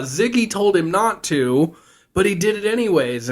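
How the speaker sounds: high-pitched, hammed-up distress; imitated panicked report. Gushy tells Al that Sam ignored Ziggy, in mock distress. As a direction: keep the pretend alarm up high.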